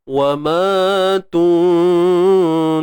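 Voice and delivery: وَمَا تُنْ- with the nun hidden, slowly nasalised; ikhfa on nun. Shown as a demonstration. Teacher demonstrating ikhfa on تُنْ, slow nasal hum. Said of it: "nun mati bertemu fa".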